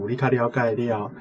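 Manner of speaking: normal talking voice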